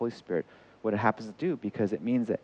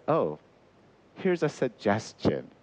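gracious suggestion; 2 semitones lower, slower than usual. Acted. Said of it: "gracious with failures".

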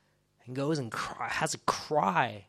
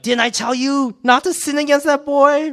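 high, loud scolding outburst; shouted rebuke; 14 semitones higher, 13 dB louder than usual. Acted. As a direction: yell it as an angry told-you-so. Acted rebuke by Reuben to brothers.

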